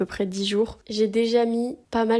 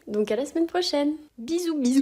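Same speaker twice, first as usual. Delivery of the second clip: cheerful sign-off; 6 semitones higher than usual. Real, not acted. Sign-off to viewers.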